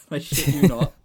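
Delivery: ordinary delivery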